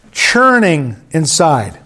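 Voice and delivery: slow, drawn-out "churning inside."; acted churning. Shown as a demonstration. Pastor acting out inner turmoil for the church, slow. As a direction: lean into "churning" as if feeling it. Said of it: "Look at me".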